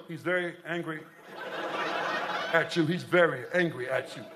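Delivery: low, measured therapist voice; put-on grave, soothing pet shrink; animal psychologist. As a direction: deliver the diagnosis low and deliberately. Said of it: "there's an animal psychologist".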